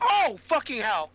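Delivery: startled yell; jump-scared shout; scared horror game streamer. Real, not acted. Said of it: "That actually jump scared me".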